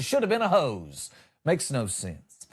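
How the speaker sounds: usual speaking voice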